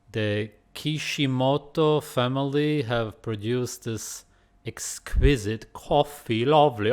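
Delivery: put-on slow, showy British accent, lightly done. Reading a coffee label aloud in a put-on British accent. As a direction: read it out like a posh Brit. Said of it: "why am I talking in such an accent".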